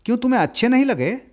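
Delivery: taken aback. A narrator's reading. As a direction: rush the question out, startled. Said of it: "कुछ अप्रभित होकर कहा". (चौंककर, तेज़ और ऊँचे स्वर में)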